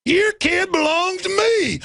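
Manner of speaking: high, mocking, slowing toward the end; mocking the cop. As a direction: sneer it like a smug crooked cop. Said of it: "He's like".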